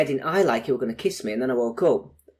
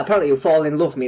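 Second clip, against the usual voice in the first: normal talking voice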